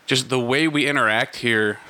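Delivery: ordinary talk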